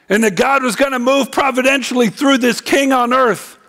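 high, driving sermon delivery; emphatic preaching. Acted. Preacher laying out the grassroots king view, emphatic preaching.

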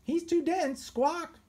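high squawky parrot quip. Acted. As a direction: chirp it like a cheeky parrot. Parrot comments on Pegleg.

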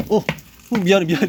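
panicked apology to the turtle; real fluster. Real, not acted.

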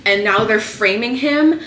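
loud, rising exasperated rant; real frustration. Real, not acted. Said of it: "I'm just so frustrated".

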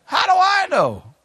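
high, whiny play-dumb voice; mock whiny innocence. Preacher acting dumb to the post office, in a whiny voice. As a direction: squeak out a fake-innocent denial. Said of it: "but I said".

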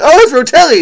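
high, loud mock-smug quote; mocking his old self. Imitating his earlier wrong self, high and loud mock-smug. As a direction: parody your own mistaken certainty.

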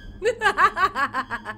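rattling run of laughter. Real, not acted. Player laughs at the ugly monster, giggling.

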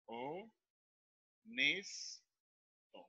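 "o, nes, to" softly, with long pauses between; quiet dictated syllables. Shown as a demonstration. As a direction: say "o", "nes", "to" as separate drawn-out syllables.

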